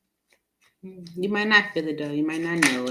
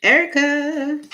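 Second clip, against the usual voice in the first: high, loud name call; 10 semitones higher, 6 dB louder, slower than usual. Real, not acted. Calling out for viewer Erica.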